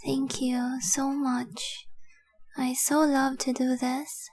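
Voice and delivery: slow, breath-filled reverie, long pauses between words; entranced adoration. Acted. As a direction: drift off softly while gazing at your treasure. Entranced staring at her treasure.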